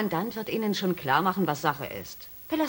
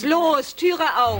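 higher, louder command; urgent shout; 6 semitones higher, 6 dB louder, much slower than usual. Acted. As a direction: bark it at the locked door. Demanding the door be opened. (Lea fordert laut, dass die Tür aufgeht)

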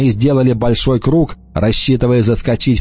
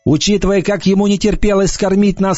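higher, tense warning; Енах, мрачно; 8 semitones higher than usual. A narrator's reading. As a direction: raise the pitch for the gloomy boy. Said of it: "Енах поясняет". (мрачный голос Еноха)

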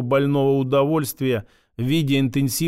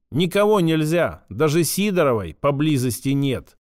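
usual speaking voice

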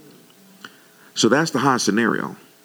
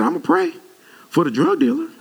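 much higher, laughing through the words; laughing reply. Retold or imitated. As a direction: laugh while delivering the punchline. Pastor retells his own reply.

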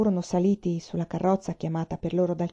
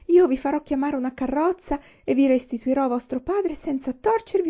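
high, louder desperate begging; frightened pleading; 7 semitones higher, 3 dB louder than usual. A narrator's reading. Jailer begging Aiwan to spare his post, high frantic pleading. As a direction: beg fast and high, terrified of losing your job. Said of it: "supplicò".

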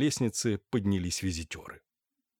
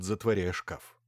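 usual speaking voice

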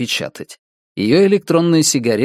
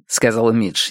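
ordinary delivery

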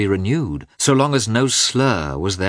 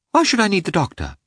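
indignant; 12 semitones higher, faster than usual. Acted. Anna bristles at her husband.

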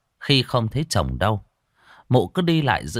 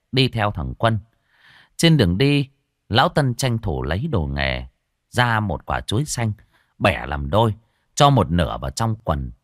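plain reading voice

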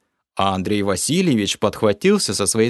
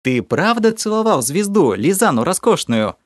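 ominous; 8 semitones higher than usual. A narrator's reading. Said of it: "Зловеще поинтересовалась".

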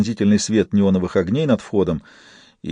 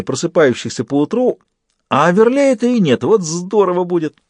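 excited; 6 semitones higher than usual. A narrator's reading. Fist gleeful over his scheme.

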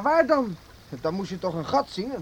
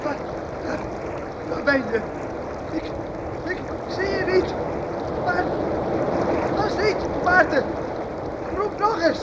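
anxious calling; 7 semitones higher than usual. Acted. Searching in panic.

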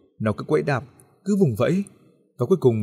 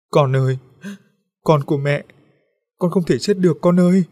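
anguished mother crying out, higher and louder; wailing grief; 6 semitones higher, 5 dB louder than usual. A narrator's reading. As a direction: wail over a lost child. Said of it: "khóc gào như điên loạn".

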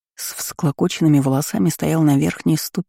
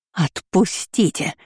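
shrieked; 3 semitones higher, slower than usual. Acted. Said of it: "завизжала баронесса".

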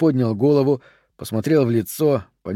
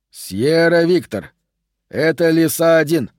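higher, urgent radio voice, slowed and tense; agitated radio call; 4 semitones higher, 3 dB louder, slower than usual. A narrator's reading. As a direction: call in over the radio, worried and pressing. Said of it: "говорил взволнованный голос". (взволнованный голос по рации, выше и напряжённее)

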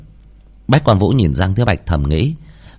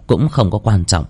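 plain reading voice

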